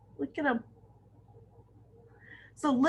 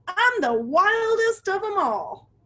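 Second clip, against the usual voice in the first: boastful excitement; 10 semitones higher, 4 dB louder than usual. A narrator's reading. Penguin boasts at the club.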